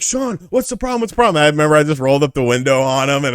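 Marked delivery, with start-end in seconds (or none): 0.0-1.4 high-pitched, fussing store manager; agitated manager. Retold or imitated. Store manager running out to the car window, high agitated questioning. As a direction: plead in a raised, urgent pitch. Manager runs out after him.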